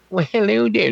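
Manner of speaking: high-pitched nerd impression. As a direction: put on a comedian's high nerdy voice.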